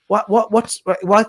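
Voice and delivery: ordinary talk, nothing marked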